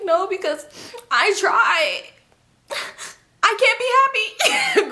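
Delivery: high, drawn-out tearful wail; hammed-up sobbing protest; mock crying. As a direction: imitate wailing through tears, breaking into laughter. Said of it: "She's crying".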